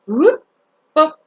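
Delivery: loud, bouncy whoop boop; playful sound effect. Retold or imitated. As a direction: flip the card with a cheerful noise. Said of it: "all he did was he went".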